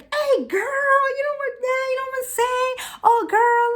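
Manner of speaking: put-on very high, animated girlfriend voice; hammed-up, high-pitched sisterly chat. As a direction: gush to your girl in a high voice. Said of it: "they talk like".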